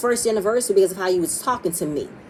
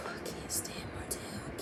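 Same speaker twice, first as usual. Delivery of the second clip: breathy whisper to herself; whispered aside; 21 dB quieter than usual. Real, not acted. Recapper whispering her dislike of Martell aside. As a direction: confide it under your breath.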